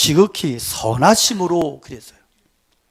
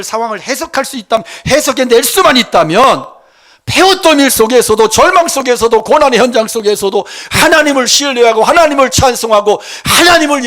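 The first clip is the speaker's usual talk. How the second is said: high exhortation building louder; full-force preaching; 4 semitones higher, 7 dB louder than usual. Real, not acted. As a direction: drive phrase after phrase to a ringing climax. (높고 힘차게 몰아치는 설교)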